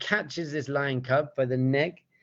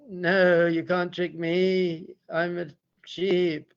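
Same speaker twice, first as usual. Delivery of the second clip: higher, drawn-out sheepish protest; sheep-like lion cub; 4 semitones higher, much slower than usual. Acted. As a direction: stretch the words like a stubborn sheep. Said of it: "the lion cub sheep thing says".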